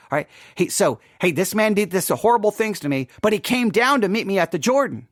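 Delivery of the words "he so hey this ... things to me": ordinary talk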